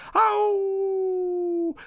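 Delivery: single high, drawn-out howl; wolf howl. Acted. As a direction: let out one long wolf howl. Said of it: "udirono tutti un ululato". (ululato acuto e prolungato)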